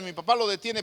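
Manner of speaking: usual speaking voice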